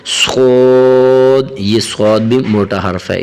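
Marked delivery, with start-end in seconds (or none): 0.1-1.6 صاد said thick, full and drawn out; heavy saad. Shown as a demonstration. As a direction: fill the mouth for a heavy صاد. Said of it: "اسے بھی موٹا کر کے پڑھنا چاہیے".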